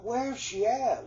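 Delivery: high, rushed call across the graveyard; the boy calls out looking for the vanished woman, alarmed; startled calling out. Retold or imitated. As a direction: shout it, hunting for her. Searching the graveyard for the ghost.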